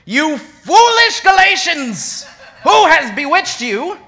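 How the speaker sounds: drawn-out sarcastic rebuke; sassy, sarcastic reading. Acted. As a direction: read it like an exasperated scold. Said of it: "He gets so sassy".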